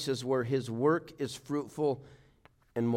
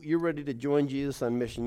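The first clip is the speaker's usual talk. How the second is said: ordinary talk, nothing marked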